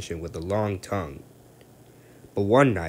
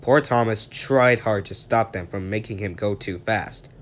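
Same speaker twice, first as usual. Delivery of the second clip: ordinary delivery, nothing marked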